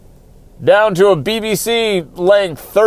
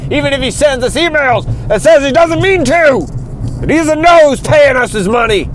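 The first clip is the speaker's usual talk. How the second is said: rising, pitch climbing into a hammed-up shout; mock upset rant; 5 semitones higher than usual. Host begging listeners, building to a mock shout. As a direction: plead for America like a desperate telethon host. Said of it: "I'm sorry to get so upset".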